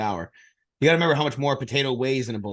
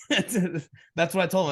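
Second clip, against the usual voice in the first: ordinary delivery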